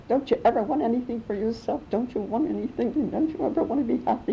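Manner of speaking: a touch of mock frustration at mother; retold annoyance. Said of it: "once I got so annoyed at her".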